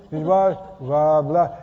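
slow, loose-tongued slurred "blah, blah"; lazy slurring demo of "blah, blah"; slurred speech. Shown as a demonstration. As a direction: let the tongue go slack and mumble "this way, blah". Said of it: "if I just slur my speech".

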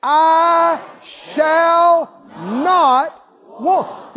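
loud, high, drawn-out shout; emphatic proclamation. Shown as a demonstration. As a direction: stretch every word into a shout. Proclaimed again for congregation.

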